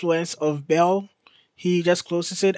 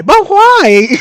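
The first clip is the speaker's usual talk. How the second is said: high, loud laughing wail; wailing disbelief; 13 semitones higher, 11 dB louder than usual. Real, not acted. Reader who knows why but protests, wailing disbelief. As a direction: wail the question in mock protest.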